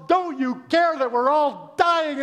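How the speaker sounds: high, loud, drawn-out mock panic; panicked yelling. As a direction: yell it over the storm. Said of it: "they're yelling around".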